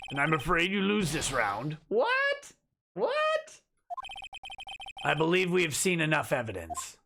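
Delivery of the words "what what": very high, shrill shocked shriek; shocked squeal. Acted. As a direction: squeak in total disbelief.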